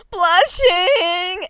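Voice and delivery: high loud swoon; swooning squeal. Acted. Author swooning mid-story, high giddy squeal. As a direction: squeal like a smitten fan.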